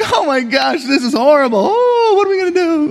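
high, wailing mock despair; hammed-up horror, stretching the "oh"; mock panic. Pastor showing how joyful people don't react, mock panic.

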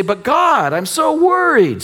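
high, slow whining worrier; mock worried whine. Pastor mimics a congregant's fretful prayer, slow high whine. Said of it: "And we say".

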